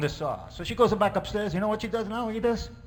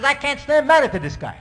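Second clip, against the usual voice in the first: mock scream; 6 semitones higher, 6 dB louder than usual. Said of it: "She comes screaming on us".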